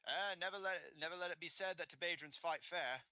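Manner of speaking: high, brisk bandit boast; shrugging bandit. Acted. Bandit shrugs to the party, boasting. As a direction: toss it off as the bandit.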